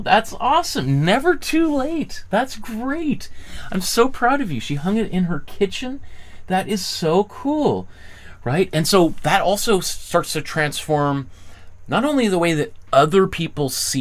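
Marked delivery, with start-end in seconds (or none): 0.0-7.9 excited praise. Real, not acted. Reacting to viewer's card.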